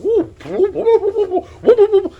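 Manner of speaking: very high, loud whoops with a laugh; joyful whooping. Real, not acted. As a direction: whoop like you just won the jackpot. Celebrating the cheap guitar buy.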